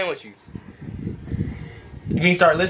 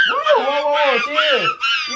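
high sudden whoa, then a slow chill; startled whoa; 6 semitones higher, much slower than usual. Real, not acted. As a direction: caught off guard, calming her down. Toddler suddenly lunging at dad.

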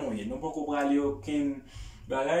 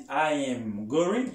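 very slow I am going; slow full form demo; 2 semitones lower, much slower than usual. Shown as a demonstration. Teacher showing what Americans do not say, slowly. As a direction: stretch out I am going word by word. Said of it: "Li pa di".

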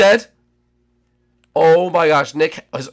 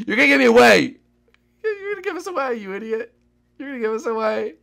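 mock scolding; 10 semitones higher than usual. Scolding Bushy for giving away hideout.

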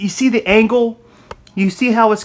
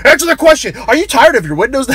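mock host into ad; 6 semitones higher, 3 dB louder than usual. Mashing demand with ad.

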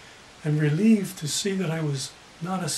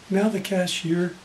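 ordinary talk

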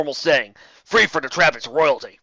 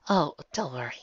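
whisper; 4 dB quieter than usual. Acted. Celestia whispering.

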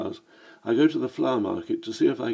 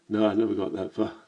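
ordinary delivery, nothing marked

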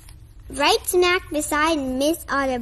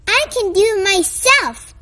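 louder, stubborn shout; 5 semitones higher, 5 dB louder than usual. Acted. Froggy refuses Frogalina's help, stubborn shout. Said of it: "shouted Froggy".

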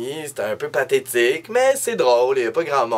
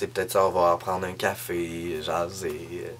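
ordinary talk

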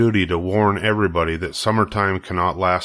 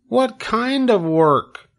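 put-on high, hopeful young pig voice, slow and pleading; a piglet eagerly asking his friend along; Wilbur the pig; much slower than usual. Wilbur asks Charlotte to come, high hopeful piglet voice.

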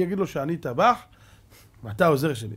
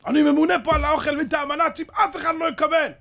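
high, loud, indignant declaration; forceful refusal; 11 semitones higher, 5 dB louder than usual. Retold or imitated. Kitchen chief refusing food to starving partisans, forceful refusal. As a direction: refuse them at the top of your voice.

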